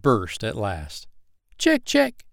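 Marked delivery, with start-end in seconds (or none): 1.6-2.3 much higher, tiny peeping voice; the freshly hatched duckling peeping its first sounds; newborn chick. Acted. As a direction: peep like a new hatchling.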